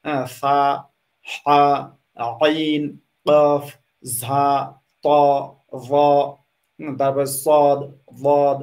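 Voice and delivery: each Arabic letter name sounded out slowly; slow, careful Arabic letter demo; Arabic letter sounds. Shown as a demonstration. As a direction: demo the hard Arabic consonants one by one. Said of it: "যেমন সা আছে".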